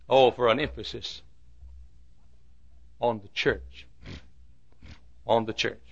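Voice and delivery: low, soft longing plea; hushed plea. Real, not acted. Preacher longing for emphasis on church, hushed.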